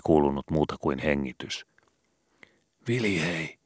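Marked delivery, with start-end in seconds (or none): 2.9-3.6 quiet, sorrowful opening; sad. A narrator's reading. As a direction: say his friend's name sadly. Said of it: "aloitti Skobe surullisella äänellä".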